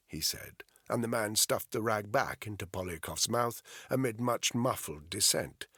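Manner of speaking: ordinary talk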